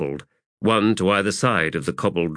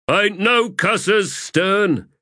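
high-pitched shouted-back reply; calling back; 13 semitones higher, 4 dB louder, slower than usual. Acted. Sapper answering the corporal's order, calling back.

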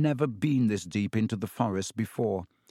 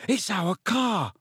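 excited, raised-pitch call; breaking with relief; 9 semitones higher than usual. A narrator's reading. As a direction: shout the good news, overjoyed. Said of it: "his voice breaking with relief".

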